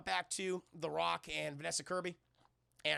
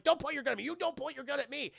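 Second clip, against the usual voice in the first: raised, high mock protest; indignant warning; 7 semitones higher, 5 dB louder than usual. At gunpoint in a standoff, raised indignant warning. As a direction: snap back defensively.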